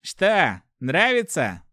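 high, loud gloating taunt; vicious taunting question; hissed taunt. A narrator's reading. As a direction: sneer it into the victim's face. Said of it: "прошипел в самое лицо стражник".

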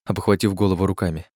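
ordinary delivery, nothing marked

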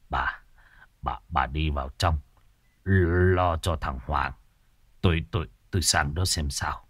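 low, soft, faltering voice; Hỏa telling his wife to go inside; stunned and quiet. A narrator's reading. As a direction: stammer gently to the wife, in shock. Husband stunned, steadies fallen wife.

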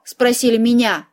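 plain reading voice

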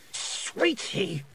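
sharp, high hiss of a queen. A narrator's reading. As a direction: spit the word like a cold queen. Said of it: "she hissed".

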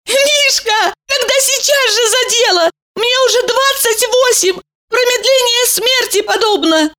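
high eager woman's voice; Valeria's voice. A narrator's reading. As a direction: urge him on, high and eager.

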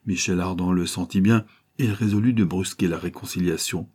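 ordinary delivery

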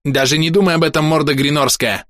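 high, heated warning; threatening warning. A narrator's reading. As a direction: warn off an enemy sharply. Warning while shielding the goblin.

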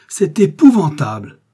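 loud, high, drawn-out exclamation; horrified cry. A narrator's reading. Brothers learning the bandit is their brother, loud horror. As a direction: cry it out slowly, appalled. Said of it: "Robert et Raoul s'écrièrent".

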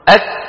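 أك closed hard, then a puff of breath; kaf stop then breath. Shown as a demonstration. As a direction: release أك with an audible breath. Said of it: "تقولها هكذا". (أك بشدة ثم همس)

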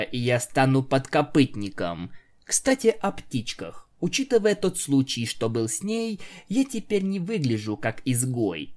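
plain reading voice

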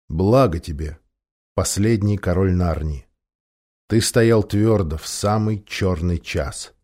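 low, measured, solemn voice; Aslan, solemn blessing. A narrator's reading. Great lion blessing the last king, solemn. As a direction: bestow praise in low, grave tones.

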